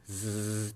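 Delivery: ズズズズ repeated as a voiced buzz; voiced z demo. Shown as a demonstration. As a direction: hum through a steady z. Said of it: "声帯が震えて". (声帯を震わせて「ズズズズ」)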